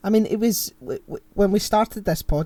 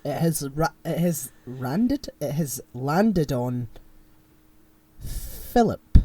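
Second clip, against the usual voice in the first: dramatic reveal; much slower than usual. Acted. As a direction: stretch the suspense, then announce the name. Drawn-out reveal of wheel result.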